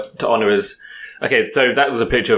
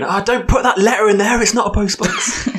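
high mock yell, laughing at the end; hammed-up shouting at a stranger; 10 semitones higher than usual. Imitating someone shouting at a letterbox.

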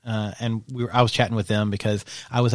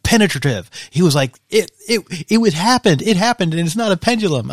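high, loud, giddy retelling; bubbling over with scandal; all excited; 8 semitones higher, 6 dB louder than usual. Retold or imitated. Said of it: "he was, like, all excited".

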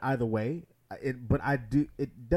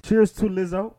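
cheerful toast; 8 semitones higher, 10 dB louder than usual. Real, not acted. Toasting [name] at dinner table.

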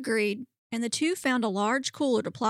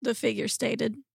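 usual speaking voice

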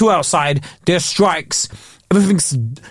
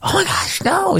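high whispered gasp of alarm; breathy horrified little squeal; imagined panicking dog, whispered and high; mock panic; 14 semitones higher, 3 dB quieter than usual. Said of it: "she's not like".

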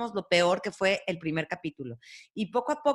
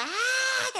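high mock scream of fright; a light imitated shriek; retold scream. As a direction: squeal like seeing a cockroach. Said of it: "estaba gritando y gritaba".

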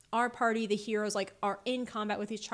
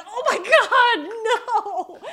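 high loud shocked yell; shocked cry; 11 semitones higher, 12 dB louder, faster than usual. Retold or imitated. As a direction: cry out in horror. Retold own shocked reaction.

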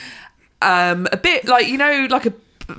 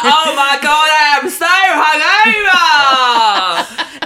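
high, hammed-up shout; loud passenger; 7 semitones higher, 6 dB louder, slower than usual. Imitating a loud man boarding the train, shouted. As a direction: bellow it, dragging out the last word. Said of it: "He got on like".

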